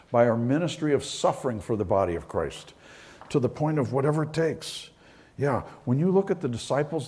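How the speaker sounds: high, loud, driving pulpit voice; preaching at full force. Acted. Pastor exhorting the congregation, preaching at full force. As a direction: preach it out to the back row.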